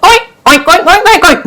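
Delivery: high squealing pig noises; pig oinks. Acted. Farmer oinks at lawyer.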